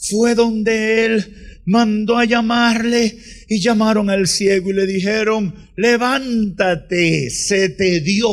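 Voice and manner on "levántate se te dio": low, slow, gentle summons; slow, tender news for the blind man; gentle call. Acted. Messengers calling the blind man.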